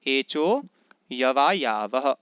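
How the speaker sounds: slow एचो, यवा यावह with long vowels stretched; sutra recitation. Shown as a demonstration. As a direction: model एचो यवा यावह for students, no अ before यवा. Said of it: "क्या उच्चारण होगा सूत्र का?". (सूत्र का धीमा, सही उच्चारण)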